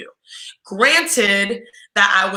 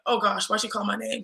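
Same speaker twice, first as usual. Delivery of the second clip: softer, worried thought to herself; worried inner thought; 8 dB quieter than usual. Retold or imitated. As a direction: fret quietly to yourself.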